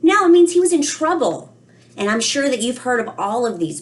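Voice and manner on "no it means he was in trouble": emphatic no. Real, not acted. Teacher corrects children emphatically.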